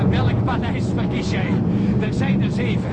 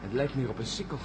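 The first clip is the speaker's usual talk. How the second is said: hushed; 7 semitones lower, 8 dB quieter than usual. Acted. Hushed exploring inside the palace.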